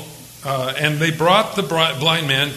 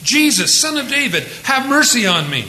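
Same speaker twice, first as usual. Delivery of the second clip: crying out; 6 semitones higher than usual. Retold or imitated. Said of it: "he began to cry out".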